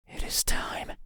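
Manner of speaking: ordinary talk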